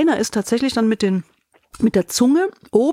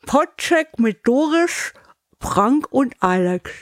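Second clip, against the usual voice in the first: slow, muffled through a cork; cork in mouth; 4 semitones higher, much slower than usual. Shown as a demonstration. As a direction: speak with a cork between teeth. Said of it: "und jetzt machen wir".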